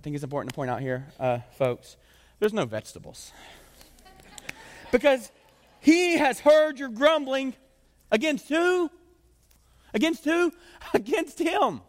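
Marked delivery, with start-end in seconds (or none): 4.9-11.8 very high, loud, drawn-out verse reading; dramatic proclamation. Acted. Preacher reading whom they grumbled against, dramatic proclamation. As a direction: call it out with mounting outrage.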